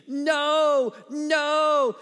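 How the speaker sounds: high, loud imitated scream; drawn-out mock scream of protest. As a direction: scream it like a panicked woman. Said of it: "a woman who started screaming".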